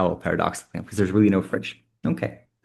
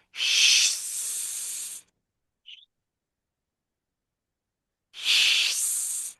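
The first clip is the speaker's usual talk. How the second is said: long drawn-out hiss; snake hiss; 3 dB quieter than usual. Shown as a demonstration. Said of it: "making a long, drawn-out S sound".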